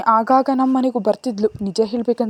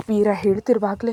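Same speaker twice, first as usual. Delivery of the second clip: ordinary delivery, nothing marked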